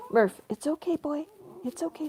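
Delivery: calm the excited dog down; soothing the dog. Real, not acted.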